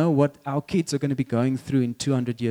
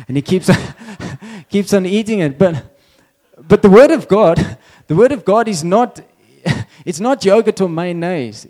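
loud, higher voice breaking into real laughter; laughing while talking; 4 semitones higher, 8 dB louder than usual. Real, not acted. Preacher cracking up at his own yogurt story, laughing. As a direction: fight through the giggles to keep talking.

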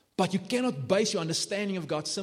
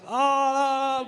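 long, high, loud "ah"; imitated child's wail; 11 semitones higher, 5 dB louder than usual. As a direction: mimic a punished child's drawn-out wail. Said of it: "I give little Katie a hiding and she's like".

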